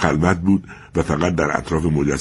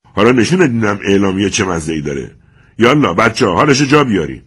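loud, higher threatening yell; angry shout; 6 semitones higher, 6 dB louder than usual. Acted. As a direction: shout at the father to scare him. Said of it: "فریاد زد".

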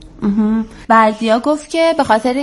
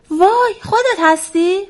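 high, drawn-out amazement; astonished question; 10 semitones higher, slower than usual. A narrator's reading. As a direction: ask it slowly in high-pitched wonder. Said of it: "وزغ با تعجب پرسید".

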